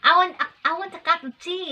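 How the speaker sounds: fast, high, loud mock British accent; imitated British accent. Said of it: "still not British accent".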